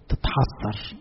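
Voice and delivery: a breathy, voiceless sigh of regret. Retold or imitated. Mocking people's regret. (تحسر بصوت هامس)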